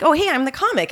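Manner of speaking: all rainbows and twinkles; bubbly eager. Retold or imitated. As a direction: sparkle like a fresh new comic. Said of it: "full of twinkles and sparkles and rainbows".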